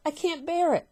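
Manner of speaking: high, loud anguished cry; frightened cry. Acted. Wife terrified of shrinking, crying out. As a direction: cry it out in terror. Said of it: "cried Missus Twit".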